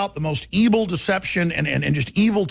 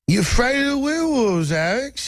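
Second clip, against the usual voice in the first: put-on higher, playful Cockney accent on "werewolves"; Oliver Twist Cockney accent; 7 semitones higher than usual. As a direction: ham up a Cockney street-urchin accent. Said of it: "that Oliver Twist Cockney accent".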